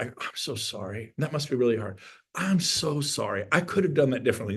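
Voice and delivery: soft, gentle acted apology; soothing apology. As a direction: play the calm husband, softly sorry. Acts out calming apology to wife.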